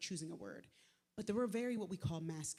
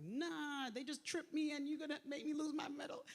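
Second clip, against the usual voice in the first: mock outrage; 7 semitones higher than usual. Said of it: "I'm like".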